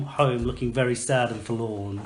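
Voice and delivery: normal talking voice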